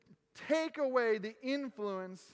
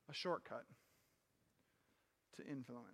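hushed refrain; 11 semitones lower, 13 dB quieter than usual. Acted. Repeated sermon refrain, dropped low.

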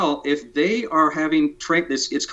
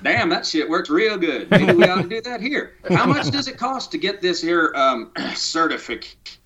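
lightly voiced rural good old boy, pitched higher; 5 semitones higher than usual. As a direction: ham it up a bit as a country good old boy. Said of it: "I'll use my good old boy for a minute".

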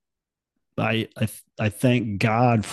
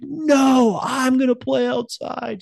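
put-on upset kid; slower than usual. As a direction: shout back like a stubborn boy. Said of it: "He's like".

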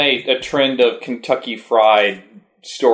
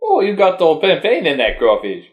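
put-on high, hammy Cajun accent; joking Cajun accent, pitched up; 6 semitones higher than usual. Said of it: "terrible Cajun accent".

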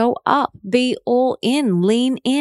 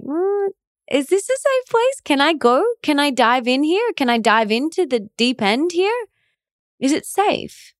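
high, unsure questioning voice; pitched-up doubtful self-talk; hesitant inner voice; 6 semitones higher than usual. Pretend. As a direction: voice your nervous inner self testing the water.

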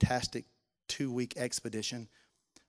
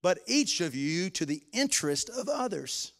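reading scripture, swelling louder and higher; building scripture reading; 5 semitones higher, 5 dB louder than usual. Acted. Pastor reading Philippians to the congregation, building to fervent preaching. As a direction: preach the passage with rising fervour.

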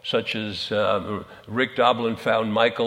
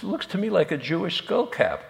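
lightly voiced fly, high-pitched; puzzled fly; 8 semitones higher than usual. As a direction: answer higher, as the joke's puzzled fly. Fly answering bee in joke.

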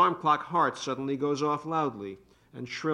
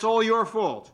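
angry blame; 8 semitones higher, 3 dB louder than usual. Acted. Couple quarrel.

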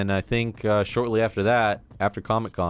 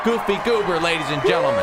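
announcer shout; 12 semitones higher, 4 dB louder, faster than usual. Real, not acted. Hyping up a subscriber.

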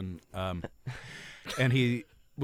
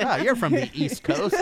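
mock question; 10 semitones higher, 6 dB louder than usual. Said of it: "you wouldn't say, like".

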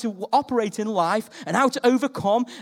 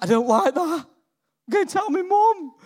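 lightly voiced hurt child, high; a hint of a little boy; 8 semitones higher, 4 dB louder, slower than usual. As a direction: whine like a smacked nine-year-old. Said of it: "I'm like".

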